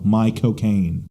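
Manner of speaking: slow Cockney "My cocaine" as Michael Caine; Michael Caine pun. Shown as a demonstration. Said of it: "the trick is to go".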